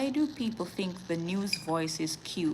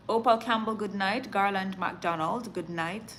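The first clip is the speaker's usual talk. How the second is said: ordinary talk, nothing marked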